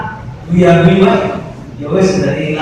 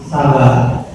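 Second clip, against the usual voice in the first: calm low; 5 semitones lower than usual. Shown as a demonstration. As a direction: drop sabar down calmly. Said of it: "guru ngomong".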